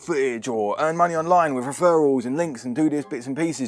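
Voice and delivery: high, loud, hammed-up bad American accent; crap American accent. People in videos selling easy money online, crap American accent. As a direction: put on a mock American hustler's pitch, pushed high. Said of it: "doing a crap American accent".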